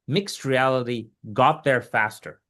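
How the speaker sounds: ordinary talk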